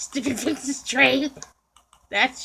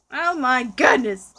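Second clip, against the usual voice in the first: usual speaking voice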